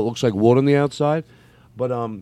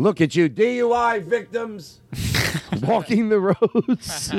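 high, drawn-out mock heckle; mock yell; 10 semitones higher, slower than usual. Comic taunting passers-by walking the roads, mock yell. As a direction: call it out high and stretched, without real volume. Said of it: "not that you would yell that".

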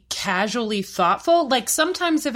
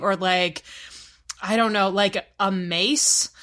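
ordinary delivery

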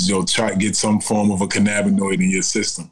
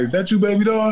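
higher-pitched grandmotherly greeting; retold, pitched-up affectionate question; imitated grandma; 12 semitones higher than usual. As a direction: lightly voice a grandma peering at someone. Voicing squinting grandma.

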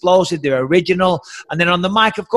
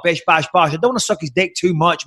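ordinary talk

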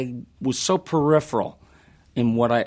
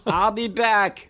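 slow, high, laughing robot voice; Terminator voice; 9 semitones higher, slower than usual. Retold or imitated. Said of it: "three dollars ... roast beef dinner".

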